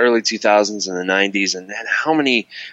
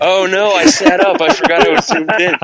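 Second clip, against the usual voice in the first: laughing; 11 semitones higher, 5 dB louder than usual. Real, not acted. Caught zoomed in on camera.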